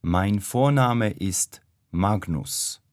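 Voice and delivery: ordinary delivery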